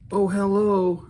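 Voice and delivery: high, loud, delighted oh hello; surprised hello. Real, not acted. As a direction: greet the guitar like a surprise guest. Admiring the finished guitar.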